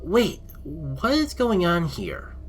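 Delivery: startled as the ship shakes; alarmed exclaim. Acted. Heroine feeling the ship lurch, alarmed exclaim.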